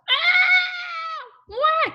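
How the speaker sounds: put-on very high, loud baby wail; bawling. A narrator's reading. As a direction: wail like a toddler in a meltdown. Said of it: "Trixie bawled".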